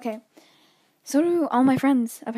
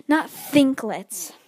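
Not Thinklets drawn out slowly; slow emphatic; 4 dB louder, much slower than usual. Real, not acted. Student mocking the assignment name Thinklets slowly. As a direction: stretch Not Thinklets in mock disdain.

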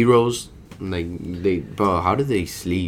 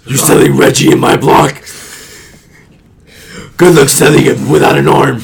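loud, high threat to a small-time dealer; menacing vigilante; 4 semitones higher, 12 dB louder than usual. Acted. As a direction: growl it like Batman scaring a criminal. Acting Batman threatening a dealer.

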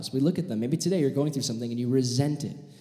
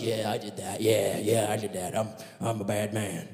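low, subdued mumbled admission; lower, softer acted confession; sheepish guilty confession; 5 semitones lower, 4 dB quieter than usual. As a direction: own up like a bad man, low and quiet. Mock confession of guilty crowd.

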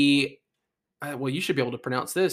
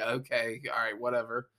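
low, quiet mock resignation; resigned; 6 semitones lower, 8 dB quieter than usual. Drenched rider imagining being hosed, mock resigned. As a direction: give in with a muted shrug. Acts out giving up when sprayed.

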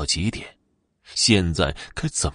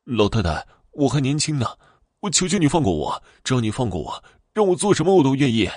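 higher, fast desperate pleading; near-tearful begging; 6 semitones higher, faster than usual. Acted. Young man begs the old ghost woman, high and fast. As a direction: beg for his life. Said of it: "差点哭了起来".

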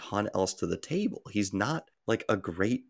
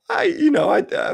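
laughing; 9 dB louder than usual. Real, not acted. Caught slipping a pick in.

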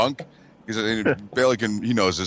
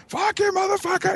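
very high mock outburst; snapping in anger. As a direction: act out finally losing it, voice leaping up. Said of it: "you finally just snap".

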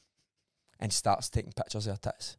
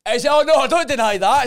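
much higher and louder, exclaiming; loud agreement; 13 semitones higher, 15 dB louder than usual. Real, not acted. Agreeing loudly over friend.

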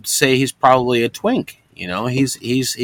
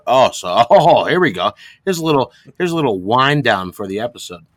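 ordinary talk, nothing marked

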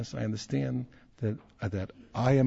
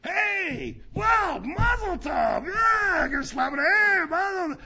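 much higher, much louder cheering at a wedding; joyful wedding shouting; 7 dB louder, much slower than usual. Shown as a demonstration. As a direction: shout your mazel tov across the hall. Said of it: "the most modest thing you can do is always go".

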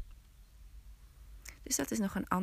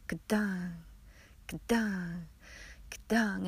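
axe chop sounds; slower than usual. Acted. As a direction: mimic an axe biting wood. Villagers chopping the willow.

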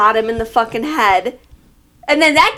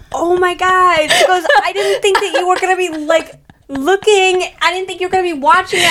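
flustered mom; 8 semitones higher than usual. Retold or imitated. Imitating mom's flustered apology.

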